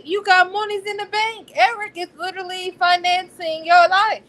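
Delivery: incredulous rant, voice climbing; can't believe she's worried about money; exasperated. Real, not acted.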